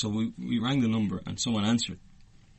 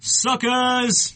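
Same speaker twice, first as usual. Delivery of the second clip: high, loud taunt; teasing the friends who believed him; gloating; 14 semitones higher, 6 dB louder than usual. Real, not acted. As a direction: crow it at the losers. Teasing the wrong guessers.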